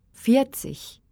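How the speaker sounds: normal talking voice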